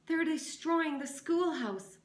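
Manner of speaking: high loud breathless plea; out of breath. A narrator's reading. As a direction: gasp it out after running. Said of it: "gasping for breath".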